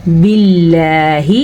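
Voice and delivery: باللہ with a light "l" and long held vowel; thin lam. Shown as a demonstration. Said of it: "باریک پڑھا جائے گا".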